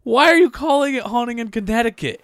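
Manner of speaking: high, loud disbelieving question; can't believe the name; incredulous. Real, not acted. Baffled by the sequel title.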